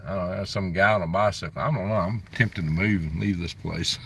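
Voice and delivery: ordinary talk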